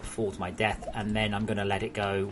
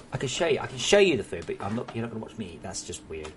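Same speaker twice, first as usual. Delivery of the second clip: normal talking voice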